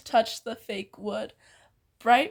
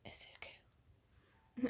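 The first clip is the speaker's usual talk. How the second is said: whispered name; 21 dB quieter, much slower than usual. Real, not acted.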